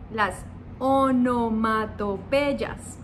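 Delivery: onomatopeyas estirada muy despacio; slow, drawn out. Shown as a demonstration. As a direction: say onomatopeyas slowly, syllable by syllable. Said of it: "tienen un nombre especial". (onomatopeyas pronunciada muy despacio)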